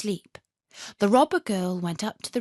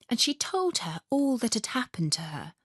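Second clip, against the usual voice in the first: ordinary delivery, nothing marked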